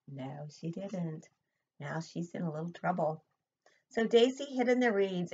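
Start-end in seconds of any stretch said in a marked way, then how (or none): none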